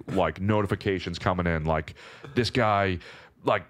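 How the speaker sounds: ordinary delivery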